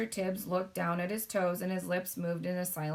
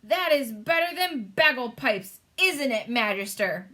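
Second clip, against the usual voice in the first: higher, louder delighted giant; shouting giant; 4 semitones higher, 6 dB louder than usual. A narrator's reading. Giant boasting to the Queen, loud. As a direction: beam with pride. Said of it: "shouted the B F G".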